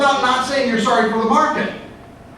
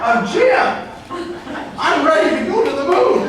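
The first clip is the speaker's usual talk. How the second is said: higher, giddy launch cheer; excited; 8 semitones higher than usual. Pretend. Speaker hyping himself for the moon launch, giddy and high. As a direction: announce the launch with glee.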